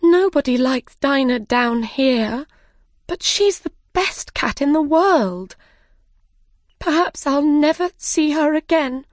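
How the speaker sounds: high, upset protest; distressed girl, pitched well up; crying out. Acted. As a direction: lament a missed friend with rising distress. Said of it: "cried Alice".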